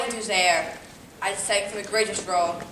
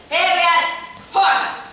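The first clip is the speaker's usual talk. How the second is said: high emphatic; 8 semitones higher, 3 dB louder, slower than usual. Acted.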